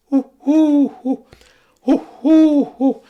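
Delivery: high, loud cooing hoo hoo; wood pigeon imitation. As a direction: coo like a wood pigeon outside the window. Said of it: "and he goes".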